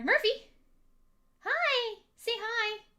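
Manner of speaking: high excited call to the pet; calling the dog. Real, not acted. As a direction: call his name bright and high to get his attention.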